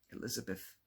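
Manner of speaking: ordinary delivery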